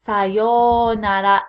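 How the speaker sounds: "Sayonara" slow, first vowel drawn out; long first vowel. Shown as a demonstration. Teacher models goodbye for students, drawn-out first vowel. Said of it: "elongate that first vowel sound".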